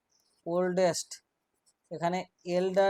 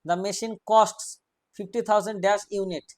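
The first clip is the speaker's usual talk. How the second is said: dictation; 4 dB louder, much slower than usual. Shown as a demonstration. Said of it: "dictating a fill-in question".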